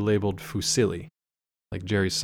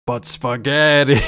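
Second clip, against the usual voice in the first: spooky punchline; 2 semitones higher, 7 dB louder, slower than usual. Acted. Punchline of creepy quote.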